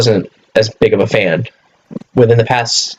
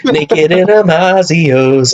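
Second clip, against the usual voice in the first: laughing punchline; 6 semitones higher, much slower than usual. Real, not acted.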